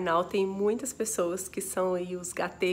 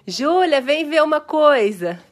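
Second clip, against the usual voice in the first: louder, raised call to the next room; 5 semitones higher, 11 dB louder than usual. Real, not acted. Mother calling daughter to come see, loud call.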